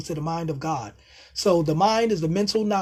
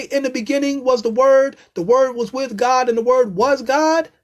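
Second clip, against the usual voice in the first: fired-up preaching; 5 semitones higher, 6 dB louder than usual. Real, not acted. Quoting John to viewers, fired-up preaching. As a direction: proclaim it like from the pulpit.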